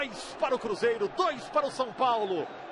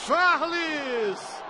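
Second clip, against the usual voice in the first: goal shout; 7 semitones higher, much slower than usual. Real, not acted. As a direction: scream the scorer's name. Cruzeiro equaliser being called.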